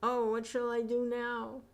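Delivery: high, strained wail; anguished cry. Acted. Preacher acts the sinner's cry from hell, high and anguished. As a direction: wail like a trapped soul. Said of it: "what does he cry out".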